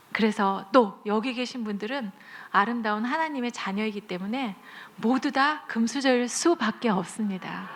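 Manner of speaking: usual speaking voice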